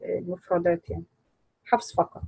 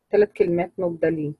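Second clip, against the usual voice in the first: ordinary talk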